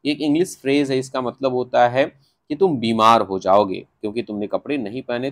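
ordinary talk, nothing marked

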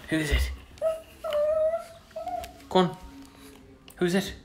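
high, soft urging of the dog; high coaxing. Real, not acted. Owner urging her pug to go find someone, high hushed coaxing.

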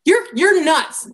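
high, slow mock scorn; ridiculing. Mourners responding to Jesus, acted ridicule. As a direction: draw it out high, dismissing him. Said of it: "they ridiculed him".

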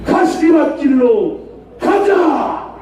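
slow, drawn-out slogan shouts; roaring rallying speech. Real, not acted. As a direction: bellow each slogan to the crowd. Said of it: "구호를 함께 외치고".

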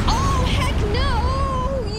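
slow, loud, drawn-out heck no; loud slow refusal; loud dread. Real, not acted. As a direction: wail it out in horror. Boss appears, no health bar.

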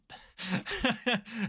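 short, high chuckle; amused laugh. Real, not acted. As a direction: chuckle at your own confusion. Laughs at forgetting the game.

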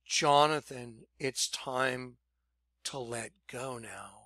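the Lord speaking gently inside; hushed voice of the Lord in his spirit; inner voice. Retold or imitated.

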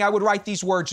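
usual speaking voice

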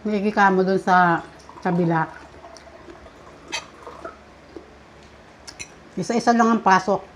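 speaking with food in mouth; words muffled by chewing; talking while chewing. Real, not acted. Seen 0: Woman chews steadily with fork in hand; unsure which diner speaks.

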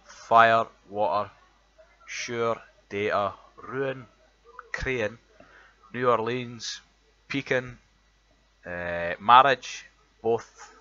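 reads each word out slowly and loudly in a broad Edinburgh accent; Edinburgh accent word list. Shown as a demonstration. Reading a word list to show his Edinburgh accent, loud and deliberate. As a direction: lays every word out clearly, showing off the Edinburgh sound. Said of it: "the words that we've got".

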